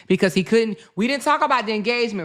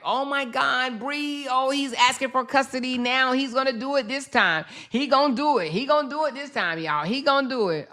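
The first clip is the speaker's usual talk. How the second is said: higher, eager mock fan voice; mock excitement; 6 semitones higher than usual. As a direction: imitate hyped-up fans, teasing. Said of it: "now they're super excited".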